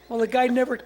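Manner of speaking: normal talking voice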